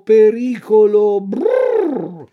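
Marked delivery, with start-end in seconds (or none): 1.2-2.2 shiver brrr. Acted. Shivering at the thought.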